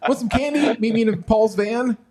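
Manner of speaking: high, sing-song creepy candy offer; creepy stranger. Pretend. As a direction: lure him in with a sickly sweet high voice. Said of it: "let me reach out to you on a video chat".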